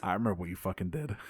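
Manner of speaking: low, quiet, quick menacing threat. Retold or imitated. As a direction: lean in and threaten under your breath. Retold villain threat.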